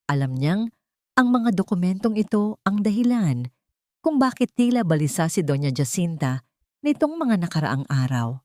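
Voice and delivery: usual speaking voice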